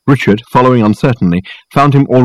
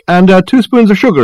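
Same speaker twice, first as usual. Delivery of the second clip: high, raised voice with a hesitation; calling back; 11 semitones higher, 5 dB louder than usual. A narrator's reading. Dirk asking Reg for sugar, calling back. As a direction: call the order through. Answering a call from kitchen.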